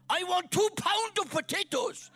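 ordering at the shop in a mock German accent. Welsh pastor in the village shop, mimicking his German hero, German accent. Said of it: "I started speaking with a German accent".

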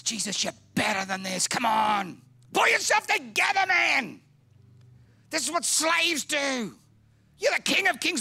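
hammed-up, high-pitched shouting tirade; enraged Peter. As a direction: yell in shrieky, exasperated protest, far above normal pitch. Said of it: "Peter is enraged. He's incensed".

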